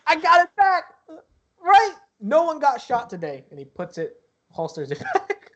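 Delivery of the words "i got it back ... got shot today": high, loud triumphant announcement; gleeful guard voice, loud and high; excited guard. Acted. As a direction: show off good news, pitched way up. Guard happily shows recovered gun.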